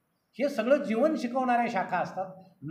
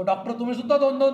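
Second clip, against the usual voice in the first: louder, imitated cry of disbelief at the doctor; retold yell; 3 semitones higher, 5 dB louder than usual. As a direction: yell it out like the confused patient in a joke. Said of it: "मग हा मग ओरडतो". (नक्कल करत ओरडून)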